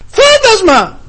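high, loud scream of fear; terrified shout. Retold or imitated. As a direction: cry out "Φάντασμα!" in terror. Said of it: "και φωνάζανε και τρομάζανε και κράζανε". (τρομαγμένη κραυγή)

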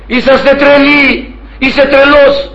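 high, drawn-out mock outrage; imitated yelling. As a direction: mimic an irate caller's accusation. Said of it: "Αυτός φώναζε".

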